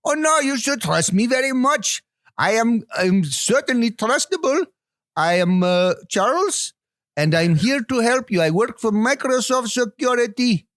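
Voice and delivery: high, wandering put-on foreign accent as a phone scammer; a scam caller claiming to be Charles in a mixed put-on accent; shifting fake accent. Scam caller tells Jack to trust him, in a high shifting put-on accent. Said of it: "your accents slip into so many different things".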